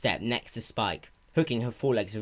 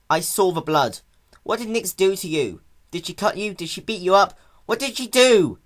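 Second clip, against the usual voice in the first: panicked; 9 semitones higher, 8 dB louder than usual. Acted. Spike panicked questions.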